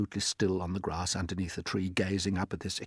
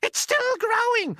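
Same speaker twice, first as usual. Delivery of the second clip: a far higher, louder aunt's shout; Aunt Spiker shouting; 7 dB louder than usual. Acted. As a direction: screech it out as the nasty aunt.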